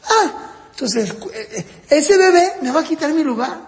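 a little wounded realisation; jealous child. Retold or imitated. Older child fearing the baby took his place, mock jealous. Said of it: "Eso es lo que más celos te provoca".

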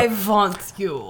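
slow seductive vampire. Pretend. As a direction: drawl it like a vampire. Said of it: "vampire slash Italian".

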